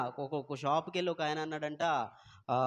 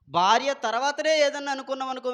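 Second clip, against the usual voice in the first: higher, louder fiery preaching; preaching at full force; 8 semitones higher, 6 dB louder than usual. Real, not acted. Preacher exhorting husbands to put their wives first, fiery and loud. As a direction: thunder the point home to the husbands. Preacher drives the point home.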